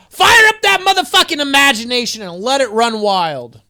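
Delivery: loud, high hype yell; full-throated pep shout; rallying shout. Real, not acted. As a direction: fire the viewers up. Rallying viewers to imagine.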